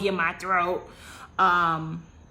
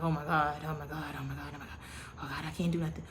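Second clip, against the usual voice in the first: inner panic; 4 semitones lower, 8 dB quieter, faster than usual. Retold or imitated. Panicking in her own mind.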